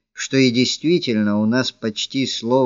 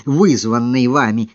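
ordinary delivery, nothing marked

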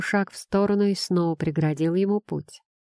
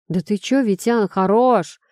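loud restraining shout; 3 semitones higher, 4 dB louder than usual. Acted. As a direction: hold back a friend, shouting. Friends restraining fighter.

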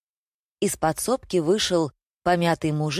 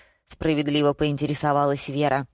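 plain reading voice

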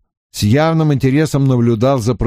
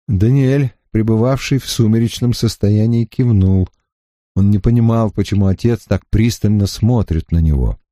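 ordinary delivery

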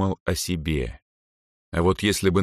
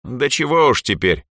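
pitched-up, shameless brush-off; unabashed; 6 semitones higher, 4 dB louder than usual. A narrator's reading. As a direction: wave it away without embarrassment. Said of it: "без всякого стеснения произнесла Эльза".

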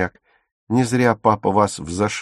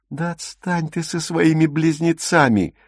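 high-pitched, exasperated dismissal; irritated brush-off; 8 semitones higher than usual. A narrator's reading. Dagmar fed up with talk of the twins, irritably. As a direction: wave them away in annoyance. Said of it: "раздражённо отмахнулась Дагмар".

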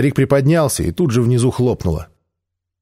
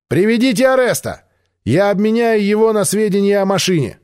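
shouted demand; 10 semitones higher than usual. Acted. Альп кричит Мире условия обмена. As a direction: shout the offer across.

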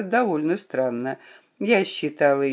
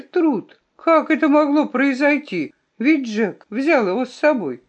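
high-pitched bewildered questioning; startled disbelief; 8 semitones higher than usual. A narrator's reading. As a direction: ask it in astonished disbelief. Nephew shocked his gun was found.

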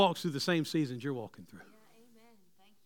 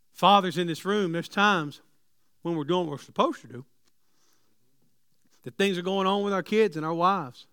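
ordinary delivery

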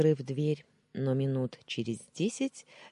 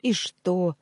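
snapping retort; 6 semitones higher, 7 dB louder than usual. Acted. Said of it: "огрызнулась я".

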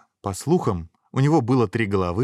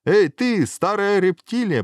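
high, loud, drawn-out taunt; emboldened shout; 13 semitones higher, 6 dB louder, slower than usual. A narrator's reading. Hero goading the old reptile, high bold shout. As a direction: holler it with bravado. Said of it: "прибодрился герой".

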